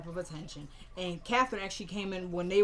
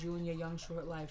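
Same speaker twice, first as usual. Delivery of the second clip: soft, lowered farewell over the casket; imitating a grandmother's soft goodbye; tender grief; 2 semitones lower, 6 dB quieter than usual. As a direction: speak gently to the dead grandson.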